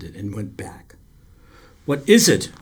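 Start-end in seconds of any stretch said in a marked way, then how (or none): 1.8-2.5 louder, pressing question; insisting. A narrator's reading. Boy presses the silent lifeguard for an answer, insisting loudly. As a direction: demand an answer. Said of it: "I insisted".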